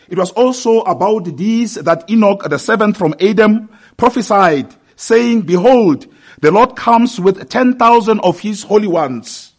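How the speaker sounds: high, loud prophetic proclamation; proclaimed scripture reading. Acted. Preacher reads out Enoch's prophecy to the church, proclaimed scripture reading. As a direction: declare the prophecy out to the congregation.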